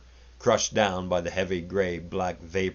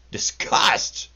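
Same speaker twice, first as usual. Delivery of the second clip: mocking yell; 3 semitones higher, 6 dB louder than usual. A narrator's reading. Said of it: "yells Sergei and laughs".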